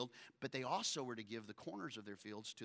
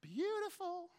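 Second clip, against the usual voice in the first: high-pitched swoon; slower than usual. Real, not acted. Remembering crush Lacey.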